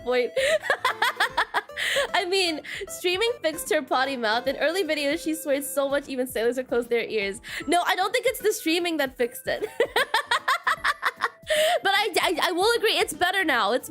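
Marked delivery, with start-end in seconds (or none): 0.4-2.1 high rising laugh. Real, not acted. Laughing at chat's joke. 9.7-11.8 long laugh climbing very high. Real, not acted. Laughing at chat's teasing.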